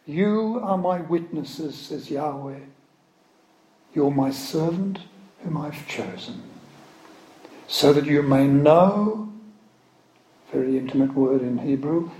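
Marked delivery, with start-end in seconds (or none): 0.1-9.3 raised, solemn proclaiming of scripture; scripture reading. Acted. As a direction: declaim it like a prophet before a congregation.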